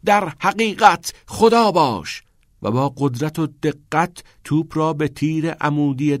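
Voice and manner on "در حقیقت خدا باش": slow, high, loud cry; shouted proclamation. A narrator's reading. Charlie shouts his line before striking the ball, proclaiming. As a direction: proclaim it grandly at full voice. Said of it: "فریاد زد".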